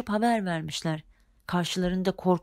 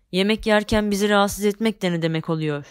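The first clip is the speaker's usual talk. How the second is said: loud, furious bellow; angry shout; 6 dB louder than usual. Acted. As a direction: roar at them for spoiling dinner. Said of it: "diye bağırmış kara şeytan".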